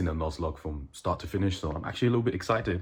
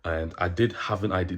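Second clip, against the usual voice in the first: usual speaking voice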